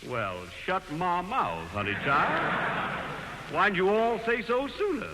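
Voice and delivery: slow, hammed-up Southern drawl; Southern accent. Jack fakes a Southern drawl to win the movie role, hammed-up Southern accent. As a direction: lay the Southern charm on thick, slowly. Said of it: "Like a minstrel show".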